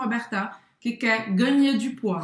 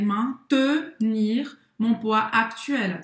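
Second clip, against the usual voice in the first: maintenir mon poids actuel, very slow and careful; slow model sentence; much slower than usual. Shown as a demonstration. Teacher models maintenir mon poids actuel very slowly. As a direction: spell it out slowly for the student. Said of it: "boca do O, fala aí".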